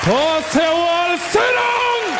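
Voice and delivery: angry Jamaican passengers ordering hijackers to sit; Jamaican shout. Retold or imitated. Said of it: "All you hear is".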